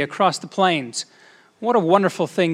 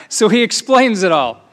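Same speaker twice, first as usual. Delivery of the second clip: ordinary talk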